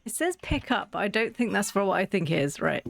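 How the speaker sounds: ordinary talk, nothing marked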